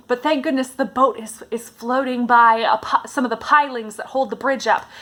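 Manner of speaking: usual speaking voice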